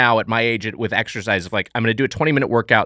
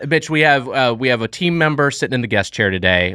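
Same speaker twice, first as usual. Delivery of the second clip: normal talking voice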